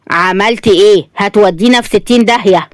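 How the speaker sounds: plain reading voice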